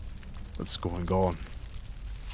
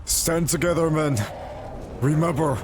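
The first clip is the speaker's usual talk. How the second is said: high, projected rallying cry; rally the troops with a raised voice; battle rally; 8 semitones higher than usual. Acted. Warrior to his men before the dragon fight, rallying cry.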